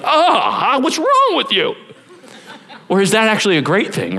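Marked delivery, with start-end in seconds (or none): 0.0-1.7 mock shock. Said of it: "I'm like".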